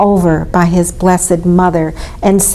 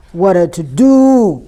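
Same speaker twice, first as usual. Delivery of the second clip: booming ox; 4 dB louder than usual. A narrator's reading. Ox remarking on the commotion, booming. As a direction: boom it like an ox. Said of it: "boomed Ox".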